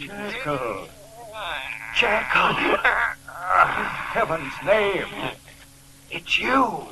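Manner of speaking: stunned whisper rising to a horrified cry; see the impossible and gasp it; horrified realisation. Acted. Lanyon horrified at transformation.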